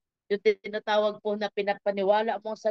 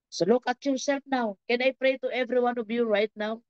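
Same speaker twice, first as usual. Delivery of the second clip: normal talking voice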